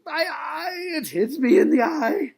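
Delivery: loud, high mock scream of pain; overdramatic wail. Shown as a demonstration. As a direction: demonstrate overacting with a pained wail. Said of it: "overdramatic about it".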